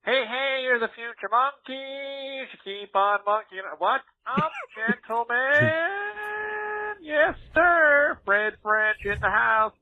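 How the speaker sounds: high, hyped-up hollering intro; exaggerated party-host greeting, building to whoops; over-exaggerated intro. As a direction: burst in whooping with excitement. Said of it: "over-exaggerated intro there".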